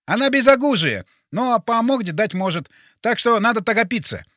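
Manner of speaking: high, insistent character voice; urgent Boris. Acted. Plays Boris.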